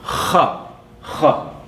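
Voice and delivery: rough voiceless "kha" scraped in the throat; throaty kha. Shown as a demonstration. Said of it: "which is the".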